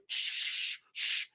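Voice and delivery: unvoiced hushing shh. Real, not acted. Hushing the viewers to keep quiet, soft shh. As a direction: hush them with a soft shh.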